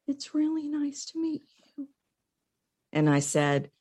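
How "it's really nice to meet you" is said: high, soft, meek man's greeting; timid Bill. Retold or imitated. Shy client Bill greets his voice coach, meek and high. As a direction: voice him small and unsure. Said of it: "And he said".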